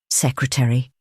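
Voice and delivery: grave, measured address; name him with solemn weight; understated seriousness; serious. A narrator's reading. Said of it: "said the president seriously".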